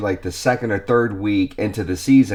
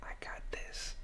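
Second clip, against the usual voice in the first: breathy whisper to his partner; whispered I got this to Karina; 24 dB quieter than usual. Retold or imitated. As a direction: whisper it, confident and close. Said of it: "I looked at her ... I said".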